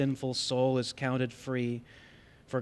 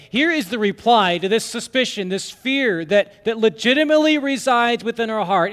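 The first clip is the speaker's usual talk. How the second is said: loud, high, driving sermon voice; full-force preaching; 7 semitones higher, 7 dB louder than usual. Acted. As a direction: preach it out with fire.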